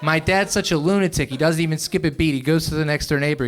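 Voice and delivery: ordinary talk, nothing marked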